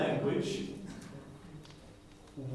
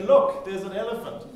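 excited call; 7 semitones higher, 8 dB louder than usual. Shown as a demonstration. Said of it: "you say".

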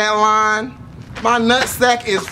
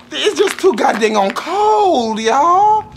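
whiny complaint; 6 semitones higher, slower than usual. Real, not acted. Complaining about the cold.

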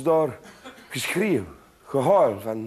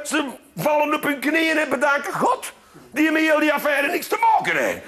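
ordinary delivery

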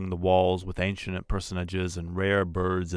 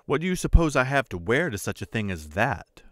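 higher, sharp impatience with her husband; impatient; 6 semitones higher, 3 dB louder than usual. A narrator's reading. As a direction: throw the question back at him, annoyed. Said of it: "declared impatiently".

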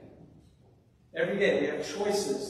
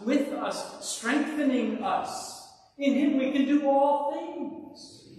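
high, raised sermon voice building to a peak; impassioned preaching; 8 semitones higher, 6 dB louder than usual. Real, not acted. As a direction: build phrase by phrase, slowing into a loud climax. Preaches scripture with rising force.

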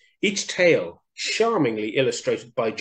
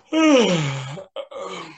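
sleepy sigh; 6 semitones higher, 4 dB louder than usual. Acted. Hare settling to sleep.

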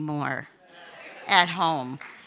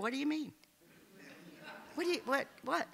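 son's shocked questions; 7 semitones higher, 12 dB quieter, much faster than usual. Retold or imitated. Child's shocked reaction retold.

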